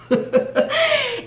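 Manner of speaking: short bright giggle; high laugh. Real, not acted. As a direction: laugh it off lightly. Laughs at own remark.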